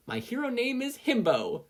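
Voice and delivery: high and bright, beaming; proud announcement. Acted. Izuku showing his whiteboard, proudly high. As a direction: present it like a triumph.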